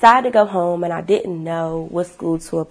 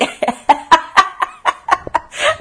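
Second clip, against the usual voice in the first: loud high burst of real laughter; big laugh; 9 semitones higher, 4 dB louder than usual. Real, not acted. Telling viewers about mom, then a loud high laugh. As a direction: crack up at the memory.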